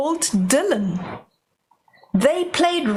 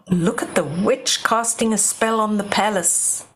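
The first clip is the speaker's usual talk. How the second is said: plain reading voice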